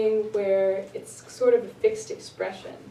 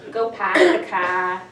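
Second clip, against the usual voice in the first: Boston accent; 7 dB louder than usual. Shown as a demonstration. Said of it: "now listen".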